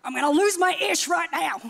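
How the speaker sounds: laughing hammed-up fury; playful mock rage; mock heated outburst. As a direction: act out losing your cool, half joking. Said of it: "I'd get heated and I'd be like".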